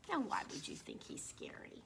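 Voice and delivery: breathy whispered question. Acted. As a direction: whisper to the children. Asks the children in a whisper.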